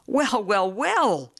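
put-on a delighted old friend calling out; hearty cry. A narrator's reading. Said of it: "he cried".

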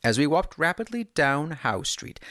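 usual speaking voice

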